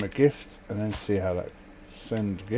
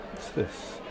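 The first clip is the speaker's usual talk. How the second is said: ordinary talk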